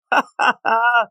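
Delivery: a high, disbelieving burst of laughter; incredulous laugh. Real, not acted. Laughing at the comic's awful dialogue, in disbelief.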